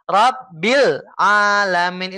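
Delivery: slow Rabbil Alamin, the bil jerked up straight into Alamin; startled, jerky reading. Shown as a demonstration. Teacher showing the wrong, jerky bil, slow. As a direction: jump off the bil too fast. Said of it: "Itu kaget".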